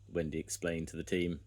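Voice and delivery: ordinary delivery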